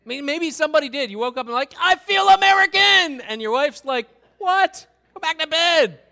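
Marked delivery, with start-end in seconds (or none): 1.8-3.2 high, booming proclamation; hammed-up patriotic yell; exuberant mock shout. Acted absurd waking-up announcement. 4.4-6.0 high, loud scolding; hammed-up exasperated yell; mock irritated wife. Acted wife snapping at husband.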